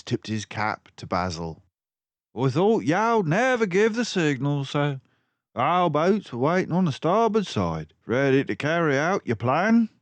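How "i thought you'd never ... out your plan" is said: high, loud policeman voice; eager constable. Acted. Constable reporting to Basil. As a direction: report respectfully.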